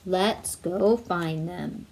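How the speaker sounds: slow, low fatherly Santa; kindly Santa. Acted. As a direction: promise it gently and slowly. Santa answers Dasher's wish.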